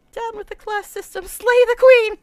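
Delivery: building to a loud rallying shout; mock rallying cry. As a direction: rouse the crowd against the queen. Mock rebel chant against queen.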